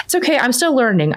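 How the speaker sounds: put-on little girl's self-talk. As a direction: gently imitate a child telling herself it's fine. Daughter's self-talk voiced.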